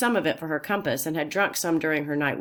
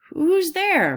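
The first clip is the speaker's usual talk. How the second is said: high loud call into the woods; shouting; 13 semitones higher, 6 dB louder than usual. A narrator's reading. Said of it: "Min Lee shouted".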